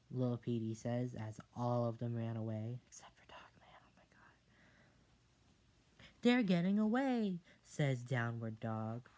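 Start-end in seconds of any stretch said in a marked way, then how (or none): 2.9-6.1 soft whisper, very slow at end; whispered aside. Real, not acted. 6.2-7.4 high, loud alarm; alarmed sidekick. Acted.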